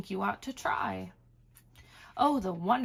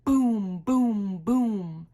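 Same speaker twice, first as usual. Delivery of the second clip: loud booming thunder; 7 dB louder, much slower than usual. Acted. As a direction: boom like thunder. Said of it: "Mister Brown makes thunder".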